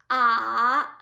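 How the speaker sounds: "ah" sinking, then rising slightly; dipping third tone. Shown as a demonstration. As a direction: dip a long "ah" down and back up. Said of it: "goes down and then rises just a little bit".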